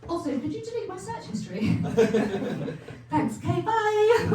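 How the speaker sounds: high, sing-song hurried goodbye; a lightly voiced tearful sign-off; mock farewell. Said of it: "I was like".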